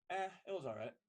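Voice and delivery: a flat, bored brush-off; unenthusiastic brush-off. Acted. Person B brushing off person A's question, bored. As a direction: shrug it off like you don't care. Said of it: "Person B responds with".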